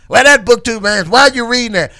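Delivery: high, loud mock scolding teacher; Miss Johnson. Imitating a teacher questioning a pupil's reading, scolding. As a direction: challenge the pupil like a disapproving teacher. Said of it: "She talked like this".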